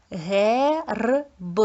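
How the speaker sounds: "Герб" broken into long vowel, trill, separate "б"; segmented "Герб" for counting sounds; sound-by-sound demo. Shown as a demonstration. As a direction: spell out the sounds of "Герб" slowly. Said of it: "зробимо звуковий аналіз слів".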